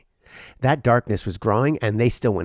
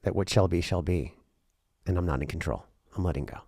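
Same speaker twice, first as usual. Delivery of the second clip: softer and lower, letting the words sink; fading surrender; 4 semitones lower, 6 dB quieter than usual. Real, not acted. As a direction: give up control as the voice drops.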